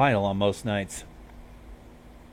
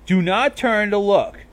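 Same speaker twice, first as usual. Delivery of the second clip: snapped; 9 semitones higher, 4 dB louder, slower than usual. A narrator's reading. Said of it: "she snapped".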